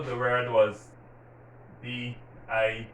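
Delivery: usual speaking voice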